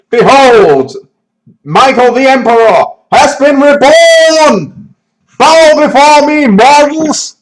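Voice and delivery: booming, high, slow villain proclamation; dark lord. Pretend. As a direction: proclaim it like a mad emperor. Proclaiming himself emperor.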